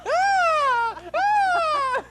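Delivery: put-on high, loud toddler shriek. Doing the kid scream voice out of the blue. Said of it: "That's why I do that voice".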